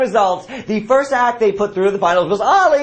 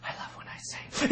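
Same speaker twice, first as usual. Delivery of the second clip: whisper; 14 dB quieter than usual. Pretend. Whispered reply.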